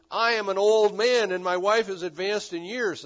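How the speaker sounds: emphatic protest. Acted. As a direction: protest forcefully as an old husband. Acts Zechariah's objection to the angel.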